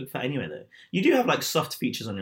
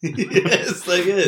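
squealed through laughter, very slow and high; laughing; 8 semitones higher, 9 dB louder, much slower than usual. Real, not acted. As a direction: gush it while cracking up. Both delighted, laughing.

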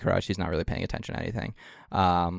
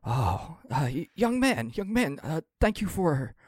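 put-on high old-man voice, gently rambling; a lonely old traveller grateful for company; 5 semitones higher than usual.

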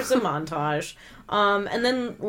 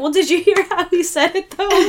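incredulous; 8 semitones higher, 5 dB louder than usual. Retold or imitated. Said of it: "I'm like".

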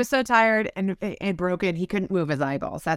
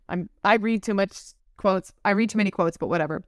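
ordinary delivery

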